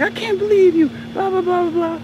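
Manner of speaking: high, loud mock outrage; shocked teacher. Teacher reacts to pupil's curse, mock shock. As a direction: imitate a scandalized teacher babbling on. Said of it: "she just looked at me all shocked".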